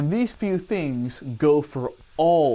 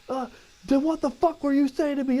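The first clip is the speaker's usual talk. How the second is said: drill sergeant; 11 semitones higher than usual. Retold or imitated. Drill sergeant yelling at recruit.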